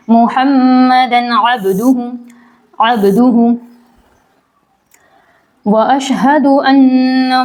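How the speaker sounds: measured, drawn-out chanting of the tashahhud; slow Arabic recitation. Acted. Teacher reciting the tashahhud slowly for students. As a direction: recite each word slowly and carefully.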